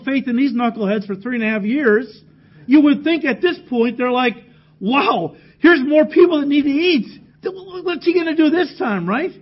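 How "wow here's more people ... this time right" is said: higher, louder, wide-eyed wow; mock amazed disciples. Preacher voicing disciples amazed at more hungry people, mock excited. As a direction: play it up like an eager disciple.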